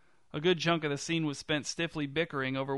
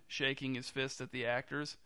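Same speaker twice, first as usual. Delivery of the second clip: usual speaking voice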